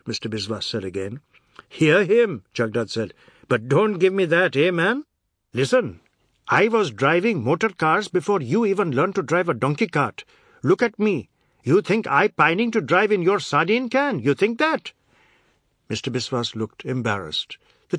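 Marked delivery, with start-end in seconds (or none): 1.7-2.4 loud, high, indignant jeer; heated outburst; angry scoff. A narrator's reading. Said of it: "At Jagdad's scream". 3.5-14.9 loud, high, indignant rant; heated ranting, rising in pitch at the end; angry tirade. A narrator's reading. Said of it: "At Jagdad's scream".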